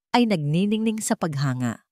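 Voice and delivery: plain reading voice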